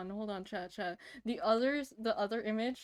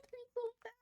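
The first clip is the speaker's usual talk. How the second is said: high soft coo; 12 semitones higher, 11 dB quieter, much faster than usual. Real, not acted. Cooing at cute art.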